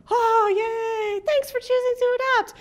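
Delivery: high, over-sweet gushing thanks; gushing delight. Pretend. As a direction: gush like an overjoyed adopter, pitched high. Gushing shelter thank-you message.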